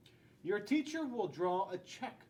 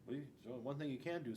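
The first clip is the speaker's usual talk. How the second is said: ordinary delivery, nothing marked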